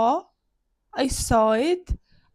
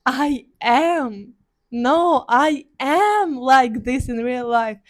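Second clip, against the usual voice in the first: retold loud protest; 7 dB louder, slower than usual. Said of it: "I'm like".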